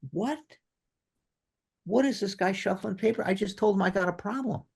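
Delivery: bewildered inner voice. Retold or imitated. Corporal baffled as the sergeant shuffles papers: high incredulous thought. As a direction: voice your own baffled disbelief in a high pitch. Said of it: "And I'm going".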